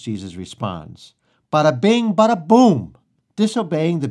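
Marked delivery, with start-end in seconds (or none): 1.5-3.0 loud, high, showy burst; punchy catchphrase. Real, not acted. Preacher caps Jesus' simple answer with a catchphrase, punchy and loud.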